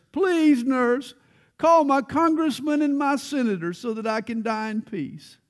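high, loud plea to the nurse; crying out. Retold or imitated. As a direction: call out pleadingly, pitched well up. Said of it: "He kept crying out to the nurse".

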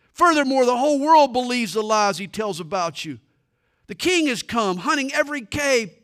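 loud, high, accusing captain; rebuking soldier. Acted. Joab reproaches David for sparing the king, loud and high. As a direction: hurl the reproach at your leader.

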